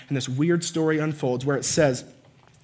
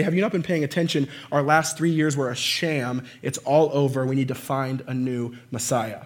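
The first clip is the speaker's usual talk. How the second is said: ordinary talk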